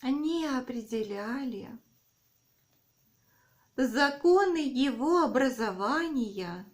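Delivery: dictating. Shown as a demonstration. Dictation, slow with repeats.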